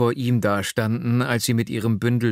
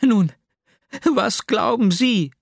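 put-on higher, aggrieved young woman's lament; Nastjenka's voice; 13 semitones higher, 5 dB louder, slower than usual. A narrator's reading. Young woman complains of her cruel lover, higher and hurt. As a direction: plead bitterly in a higher voice.

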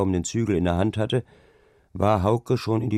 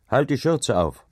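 1 called out; 6 semitones higher, 3 dB louder than usual. A narrator's reading. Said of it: "rief er ihr zu".

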